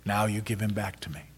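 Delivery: low, soft and tender; tender thanks. Acted. Wife thanking husband for their son, tender. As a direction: give the words gently, almost breaking.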